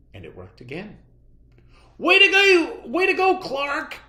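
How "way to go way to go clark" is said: much higher, louder cheer; cheering friends. A narrator's reading. Friends cheering Clark at lunch, loud and high. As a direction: cheer him on. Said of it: "said his friends".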